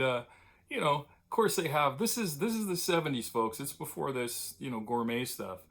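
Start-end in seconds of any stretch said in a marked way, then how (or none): none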